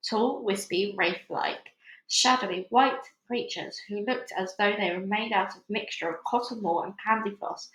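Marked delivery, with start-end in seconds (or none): none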